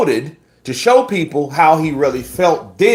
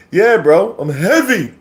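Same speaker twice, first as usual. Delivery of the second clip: put-on higher, louder British accent; British accent impression; 8 semitones higher, 5 dB louder than usual. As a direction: imitate a British heavyweight. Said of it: "That accent had me crying".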